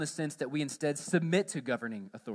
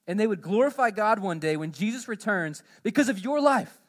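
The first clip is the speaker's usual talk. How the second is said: rising, louder impassioned preaching; preaching at full force; 5 semitones higher, 7 dB louder than usual. Acted. Preacher urging believers to live well, impassioned. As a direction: build the exhortation to a fervent peak. Preacher paraphrasing Peter's exhortation.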